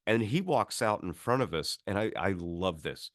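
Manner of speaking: normal talking voice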